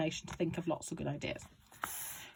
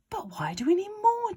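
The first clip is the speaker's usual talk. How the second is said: put-on high, questioning boy; lightly voiced boy George; 13 semitones higher, 4 dB louder than usual. George asking his father why they need more, lightly voiced. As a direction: ask like a puzzled son.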